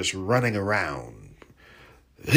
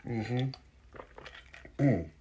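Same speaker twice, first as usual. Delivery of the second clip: soft hum after a sip; savouring a sip of soda; while sipping a drink; 3 semitones higher, 9 dB quieter than usual. Real, not acted. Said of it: "You might hear me sip on a little bit".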